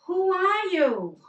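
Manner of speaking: high, loud, slow shout of "Who are you?"; boy shouting into the valley; yelled question. Retold or imitated. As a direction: call it out across the mountains. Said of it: "so he yelled".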